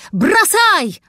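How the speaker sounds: loud, high roar; roared command. A narrator's reading. Cat over the rumbling platform, roaring a command. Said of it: "взревел".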